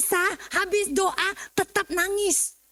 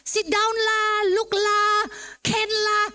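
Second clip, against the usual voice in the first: quick, higher Singlish with lah tacked on; mock a Singaporean speaker with lah, pitched up; Singlish imitation; 5 semitones higher, much slower than usual. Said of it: "itu cuma orang Singapura".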